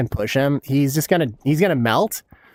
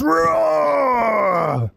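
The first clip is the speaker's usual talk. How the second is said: roar; 8 semitones higher than usual. Pretend. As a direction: roar like a beast at them. Taunting enemies on proximity chat.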